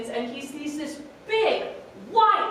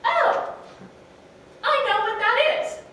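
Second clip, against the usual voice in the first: higher, louder, quicker delight; excited penguin; 8 semitones higher, 5 dB louder than usual. A narrator's reading. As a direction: gush it like you spotted dessert.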